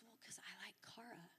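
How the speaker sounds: soft breathy whisper of a shy confession. Retold or imitated. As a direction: whisper it so nobody hears. Boy confessing quietly.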